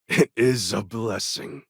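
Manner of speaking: ordinary talk, nothing marked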